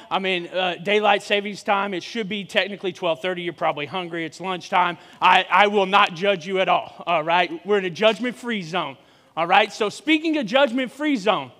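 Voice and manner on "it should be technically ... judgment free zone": ordinary talk, nothing marked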